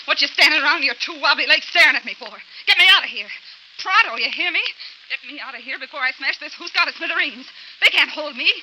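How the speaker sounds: high, loud angry outburst; furious demand. Acted. Linda to her lawyer, furious and loud. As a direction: rage at him to get her out.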